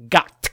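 GOT as one abrupt Klingon-style word; "got" with a hard Klingon-style ending; mock-Klingon pronunciation. Shown as a demonstration. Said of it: "as if it were a Klingon word".

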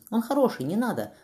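put-on a frightened girl begging from above; child's voice. A narrator's reading. Girl calls down from the window, pleading in a high child's voice. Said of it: "раздался детский голос сверху".

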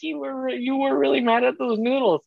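high teasing voice, slowed down; high mocking disbelief; mock incredulous. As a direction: tease him in a high sing-song. Mocking son about soup.

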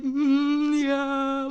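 small, drawn-back nervous answer; retold shy, tongue-tied murmur; nervous reply. Fan answering the singer about a picture, nervous. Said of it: "you're like, all nervous".

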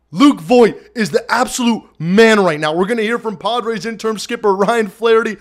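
ordinary talk, nothing marked

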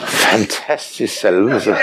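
slow, mock-reverent "fantastisk salvelse"; dry sarcasm. Preacher sarcastically praising a droning song's anointing. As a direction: say it slowly with ironic awe. Sarcastic about worship song.

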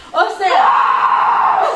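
mae, o sea dissolving into a high laugh; laughing outburst. Real, not acted. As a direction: burst out laughing in outrage. Estalla de risa indignada. (estallando en risa aguda e indignada)

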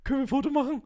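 high, eager fan voice asking; imitating a fan. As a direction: play the starstruck fan asking politely. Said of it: "Und da kommt einer".

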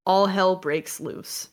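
usual speaking voice

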